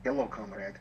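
low, softly put-on Russian accent. Shown as a demonstration. Manager answers a coworker's running joke, Russian accent. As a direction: greet a comrade in a mock Russian voice. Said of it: "speak to each other in a Russian accent".

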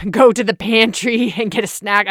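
chuckles out the snack bit; laughing. Real, not acted. To listeners, laughing while denying a snack break.

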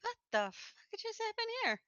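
high-pitched, softened retold shock; stunned disbelief. Winner echoing co-host's next-day reaction, stunned disbelief. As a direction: echo the bafflement in a small, high voice.

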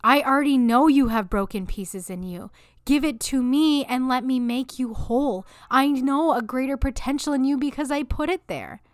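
loud, rising, insistent declaration; emphatic voice of God. Retold or imitated. God telling a broken listener to hand it over, loud and emphatic. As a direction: drive it home with mounting force, voicing God. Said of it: "and he's saying".

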